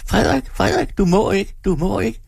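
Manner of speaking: put-on high shrieking plea, girl begging. A narrator's reading. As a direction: shriek his name in terror. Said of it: "En pige hvinende". (pige der hviner højt)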